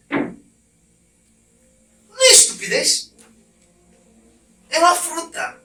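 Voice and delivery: high, drawn-out mocking disbelief; incredulous scorn. Real, not acted. Preacher scorns trading God for a fruit, drawn-out high disbelief. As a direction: stretch it out like it's absurd.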